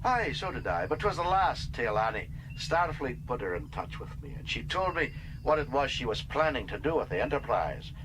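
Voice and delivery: Scotty telling the captain why he came back; Scotty's voice. Acted. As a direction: lightly play the old Scottish chief engineer, fond and wry. Scotty speaks to Kirk.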